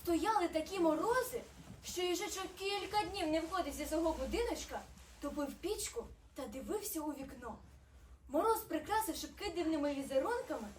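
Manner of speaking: usual speaking voice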